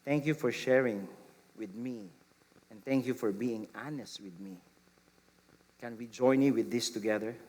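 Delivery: soft, low and tender; gentle. Shown as a demonstration. Parent gently thanking an honest child, softly. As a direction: say it gently, almost hushed. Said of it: "Gentleness would say".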